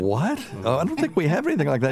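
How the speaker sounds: high, fast confused reply; puzzled pharmacist. Retold or imitated. Pharmacist answering through the window, high and quick. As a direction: rattle it off, baffled. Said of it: "And they were like".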